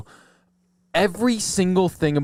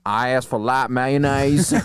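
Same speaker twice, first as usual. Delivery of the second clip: slow, exaggerated Southern drawl; Southern accent; slower than usual. Customer insisting on his mayo order, hammed-up Southern accent. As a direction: stretch the vowels like a put-on Southerner. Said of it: "I feel like Southern, you can do that".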